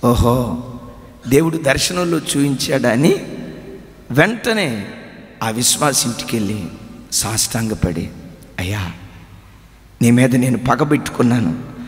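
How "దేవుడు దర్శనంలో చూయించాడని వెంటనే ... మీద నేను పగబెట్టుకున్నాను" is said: low, soft telling; hushed sermon storytelling. Acted. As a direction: draw listeners in quietly. (మెల్లగా చెప్పే ప్రసంగ శైలి)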